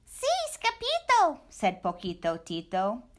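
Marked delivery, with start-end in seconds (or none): 0.0-1.4 much higher chirpy chihuahua voice; a tiny excited dog greeting his friend; squeaky little dog. Acted. Poquito Tito greeting Scapito, high squeaky voice.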